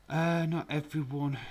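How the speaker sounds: indignant protest. Real, not acted. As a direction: push back loudly. Protesting loudly.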